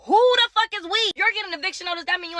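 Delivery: indignant shout at the absurd "we"; outraged. Real, not acted. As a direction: fire the question back in disbelief. Outraged retort at mom.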